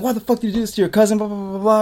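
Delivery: high, fast mock scolding; retold yelling. Aunt demanding answers from the kid about the cousin, mock yelling. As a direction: imitate an angry aunt's high-pitched rant, rattled off. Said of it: "she's fucking yelling at me".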